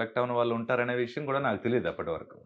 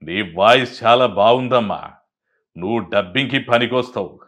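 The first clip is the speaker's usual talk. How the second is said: RCM impression; 12 dB louder than usual. Visitor praises a young man's voice in the studio, loud RCM impression. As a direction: boom out the praise, drawing the words out. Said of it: "RCM గారు స్టూడియోకి వచ్చి".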